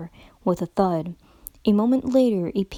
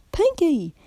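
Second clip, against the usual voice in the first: sharp call of a friend's name, pitched up; mild loud outburst at a friend; a little too loud; 8 semitones higher than usual. Acted. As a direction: call out louder than intended. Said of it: "perhaps a little more loudly than she had meant".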